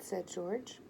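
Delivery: ordinary delivery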